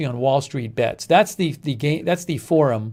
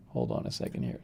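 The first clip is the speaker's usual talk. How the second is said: whispered aside; 13 dB quieter, faster than usual. Real, not acted. Aside off-mic while searching.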